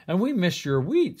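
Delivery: high, loud and cheerful; happy crows. Acted. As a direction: sing it out as friendly crows. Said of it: "said the crows".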